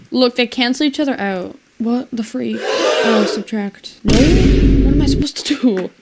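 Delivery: normal talking voice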